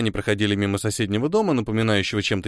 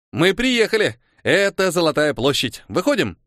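put-on high, eager boy; excited announcement; 6 semitones higher than usual. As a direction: announce the arrival with boyish excitement. Boy excitedly announces arrival, then claps.

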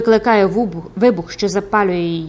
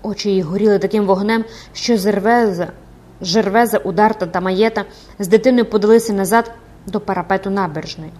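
plain reading voice

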